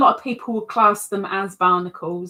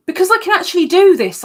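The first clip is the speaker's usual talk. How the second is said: much higher, louder, fast burst; excited; 9 semitones higher, 10 dB louder, faster than usual. Real, not acted. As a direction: blurt it out with glee. Excited about showing the screen.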